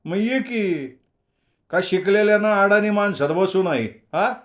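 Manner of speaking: a loud, mocking taunt; teasing challenge. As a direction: needle him for being educated. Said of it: "संभानं हटकलं".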